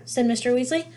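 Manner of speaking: ordinary delivery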